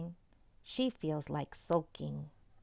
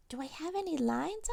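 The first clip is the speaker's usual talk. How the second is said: put-on small boy voice; 8 semitones higher, 3 dB quieter than usual. A narrator's reading. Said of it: "asks Eduardo".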